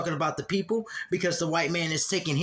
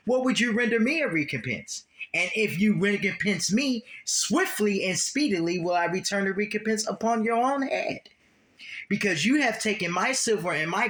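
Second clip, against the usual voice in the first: raised, declaiming Bible reading; scripture reading; 3 semitones higher than usual. Acted. Preacher reads the Lord's words aloud, declaiming. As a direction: proclaim the verse to the congregation.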